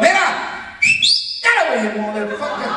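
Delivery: old grandfather's whistly speech, imitated; whistling when talking. Ninety-year-old grandfather shooing someone, exaggerated whistling speech. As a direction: let sharp whistles slip into the rapid words. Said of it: "he whistles when he talks".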